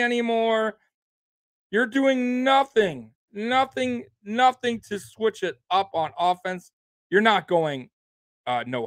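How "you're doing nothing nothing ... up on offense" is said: NOTHING hit high and drawn out, repeated; angry repetition of nothing; hammering nothing. Real, not acted. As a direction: pound the word nothing each time. Fan ranting about play calls.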